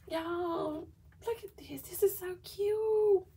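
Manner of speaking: high squeal, swelling loud on "so cute"; delighted, high-pitched fangirl gushing; real excitement. Real, not acted. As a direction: gush over something adorable, stretching it out. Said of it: "I'm just getting excited off these dashboards".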